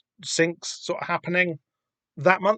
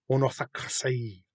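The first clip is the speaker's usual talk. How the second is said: a softer Elvish "Unorthocracy"; Elvish accent; 6 dB quieter than usual. Acted. Host repeating the name, softer Elvish accent. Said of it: "like, an Elvish accent".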